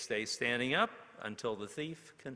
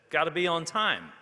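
higher and much louder, calling out; called out; 7 semitones higher, 10 dB louder than usual. A narrator's reading. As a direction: toss the rule out loudly to the room. Students calling out rules.

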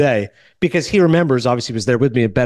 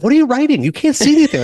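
higher, incredulous challenge; baffled, higher-pitched questioning; 9 semitones higher, 3 dB louder than usual. Retold or imitated. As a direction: put it to them in pitched-up disbelief. Mock-challenging the note-takers.

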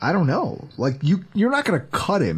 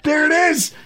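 high triumphant "there it is"; knowing exclamation; 12 semitones higher than usual. Retold or imitated. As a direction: crow it out, vindicated and pitched way up. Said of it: "And I go".

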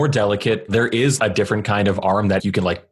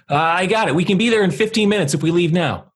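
urgent call; 10 semitones higher, faster than usual. Acted. Player character calls out urgently.

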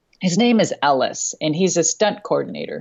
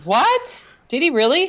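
shocked disbelief; 9 semitones higher than usual. Real, not acted. Shocked by Cornette's injury.

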